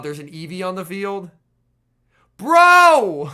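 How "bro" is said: loud, high-pitched real outcry; exasperated shout. Real, not acted. Player reacting to Eevee on the field, exasperated shout. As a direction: shout it in protest at the game. Outcry at bad turn in game.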